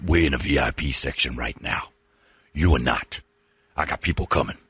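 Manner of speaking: low put-on pimp voice; dismissive pimp impression.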